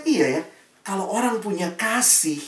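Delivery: normal talking voice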